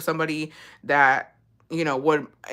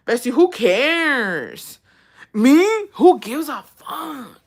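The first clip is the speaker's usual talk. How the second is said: slow, stretched, higher mock indifference; drawn-out dismissal; 7 semitones higher, 4 dB louder, much slower than usual. Real, not acted. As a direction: drag each word out like you couldn't care less. Mocking dismissal to viewer.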